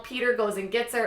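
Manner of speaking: ordinary talk, nothing marked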